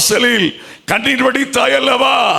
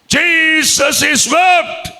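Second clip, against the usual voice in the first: drawn-out proclaiming shout; preaching at full force; 7 semitones higher, much slower than usual. Real, not acted. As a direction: hammer it out very slowly. Preaching climax.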